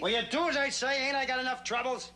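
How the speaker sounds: high-pitched, exasperated yelling; angry yell. Acted. Wade yelling at his tired girlfriend, angry. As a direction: yell the order, voice way up. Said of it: "Don't yell".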